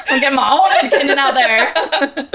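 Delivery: laughing through the words. Real, not acted. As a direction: giggle out your own theory. Laughing at own idea.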